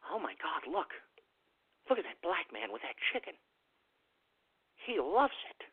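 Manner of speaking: very hushed onlooker's aside; mock furtive whisper. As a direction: imitate a gawker whispering to a companion. Imitating onlookers gossiping furtively.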